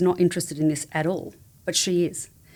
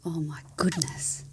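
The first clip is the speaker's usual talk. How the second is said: whispered; 6 dB quieter, slower than usual. Real, not acted. Moved by the joy question.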